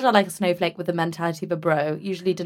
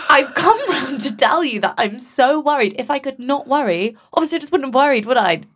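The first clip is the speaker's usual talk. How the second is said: exasperated; 7 semitones higher, 4 dB louder than usual. Retold or imitated. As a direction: drag it out in loud disbelief. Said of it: "And I was like".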